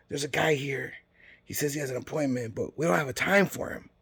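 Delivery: badly faked whisper that everyone can hear; failed whisper. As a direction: imitate someone who only thinks they're whispering. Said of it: "she thinks she's whispering. She's not".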